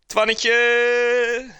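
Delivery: loud high call of a name. Real, not acted. Streamer calling out to a chat friend, loud and high. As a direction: sing out the name across the room.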